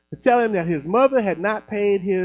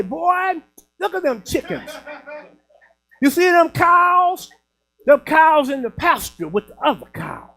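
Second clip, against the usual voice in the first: hammed-up folksy grandma, talking round a dip of snuff; grandmother talking funny; 5 semitones higher, 3 dB louder than usual. Grandmother lectures her boy from the train, talking funny. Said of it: "make her talk a little funny".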